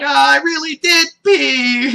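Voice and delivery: high, loud, slow mock-sheepish voice; high, drawn-out mortified girl voice; embarrassed Bulma. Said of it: "she's like".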